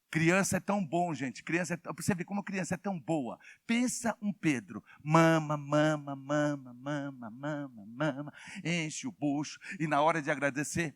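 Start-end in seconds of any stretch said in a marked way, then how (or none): 5.0-8.3 put-on soft, fading baby mumbling; imitating a nursing baby. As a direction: mimic a baby at the breast, trailing away. (imitação suave de bebê mamando)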